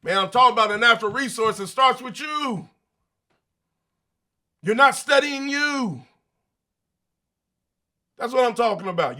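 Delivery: insistent, high-pitched rebuttal; emphatic comeback. Acted. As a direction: fire back at a doubter, pitch climbing. Fires back at imagined doubter.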